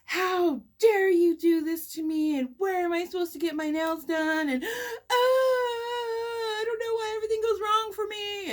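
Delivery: client crying and yelling at her nail tech, mock sob. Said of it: "she's yelling and crying and yelling and crying".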